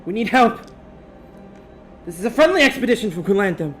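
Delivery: high, loud, frantic plea; panicked distress call. Acted. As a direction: beg for help in panic. Distress message continues.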